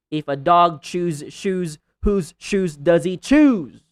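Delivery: crisp endings on dog, chews, shoes, choose, landing loud on the last word; tongue twister with emphatic word endings; clear final sounds. Shown as a demonstration. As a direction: punch out every final consonant, big finish on choose. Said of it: "clearly utter the last sound of every word".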